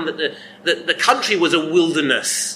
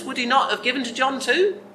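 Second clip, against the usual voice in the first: ordinary talk, nothing marked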